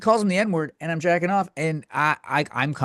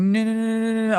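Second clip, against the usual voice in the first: mock moaning woman; 8 semitones higher than usual. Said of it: "she was like".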